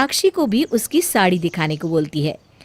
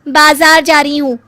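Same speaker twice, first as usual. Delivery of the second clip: higher, louder snappy daughter-in-law; irritated young bahu; 6 semitones higher, 7 dB louder than usual. Acted. Bahu answers her mother-in-law, loud and irritated. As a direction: snap back at the nagging mother-in-law.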